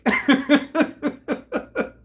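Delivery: long, high peal of laughter; real laughing fit. Real, not acted. Teller cracks up at a joke on a kid, real laughing fit.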